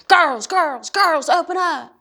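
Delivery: loud, high shout through a door; hollering. Acted. Drunk father locked out calls his girls, hollering. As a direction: holler urgently to be let in. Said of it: "starts thumping and hollering".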